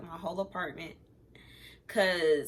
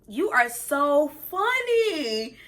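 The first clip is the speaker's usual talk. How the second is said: sarcastic; 6 semitones higher, 6 dB louder, much slower than usual. Retold or imitated. Sarcastic retort to cheating boyfriend.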